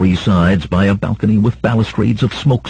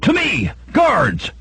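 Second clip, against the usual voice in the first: high-pitched, urgent call for help; screamed summons; 12 semitones higher, slower than usual. A narrator's reading. Merchant rallying guards to his side, high scream. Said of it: "Samarides screamed".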